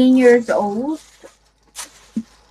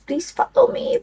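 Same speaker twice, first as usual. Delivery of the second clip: much higher, rising on follow; playful high-pitched please follow me; high pleading; 11 semitones higher than usual. Shown as a demonstration. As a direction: squeak it up high like a plea. Repeating follow me variously.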